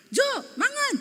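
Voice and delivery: "Jo, mangan" called out very high; sharp, high-pitched summons to a husband; panggilan bernada tinggi. Retold or imitated. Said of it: "Besoknya saya gitu lagi". (memanggil "Jo, mangan" dengan nada tinggi)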